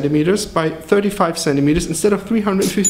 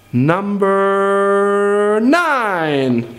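drawn-out announcement; 2 semitones higher, much slower than usual. Shown as a demonstration. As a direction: stretch every syllable of Number nine, very slowly. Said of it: "stretched announcement".

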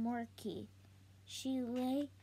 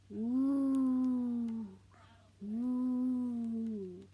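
woo sound; 5 dB louder than usual. Acted. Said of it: "said Shay".

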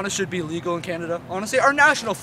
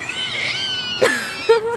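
imitated seagull screech. Imitating seagulls fleeing from him.

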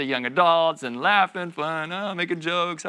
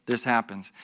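ordinary talk, nothing marked

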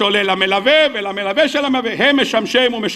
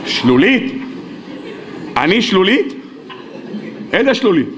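indignant denial; 3 semitones lower than usual. Acted. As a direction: throw the accusation back in disbelief. Accused friend denies crying.

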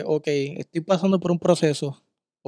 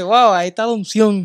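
higher, louder amazed wow; awed exclamation; 5 semitones higher, 7 dB louder than usual. Retold or imitated. Listener imitating awe at the pastor's powerful prayer, louder excited exclaim. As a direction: marvel out loud at the anointing.

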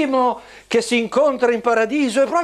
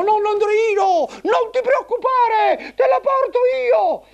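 very high squeaky bellboy puppet; eager little puppet porter, high and squeaky; squeaky shrill puppet voice; 12 semitones higher than usual. Acted.

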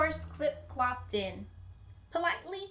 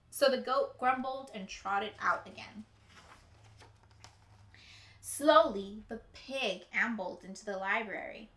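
plain reading voice

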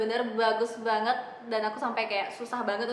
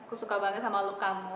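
usual speaking voice

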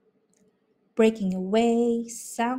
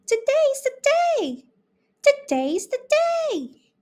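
very high squeaky mice greeting; very high, louder mouse voices; tiny mice; 5 dB louder than usual. A narrator's reading. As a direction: chirp it like two tiny mice. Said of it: "they both agreed".

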